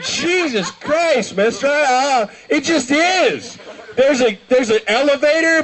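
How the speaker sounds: high-pitched, exasperated imitation; flustered host. Host answering the old man, high and flustered. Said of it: "the host is just like".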